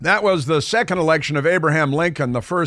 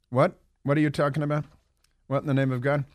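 ordinary delivery

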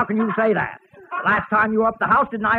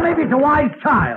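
normal talking voice

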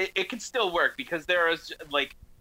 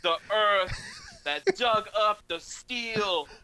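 high, slow, sing-song robot poem; droid poetry slam; 7 semitones higher, slower than usual. Pretend. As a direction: recite it like a solemn robot poet. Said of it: "That's my droid poetry slam".

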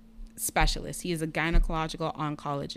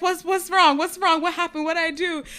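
panicked doctor; 11 semitones higher, 7 dB louder than usual. Retold or imitated. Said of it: "and he's like".

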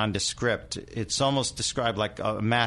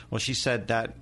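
ordinary talk

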